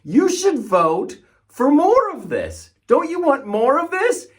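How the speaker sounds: high, slow, oily mock pitch; mocking sales pitch. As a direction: sell it like a smug huckster. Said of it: "they're like".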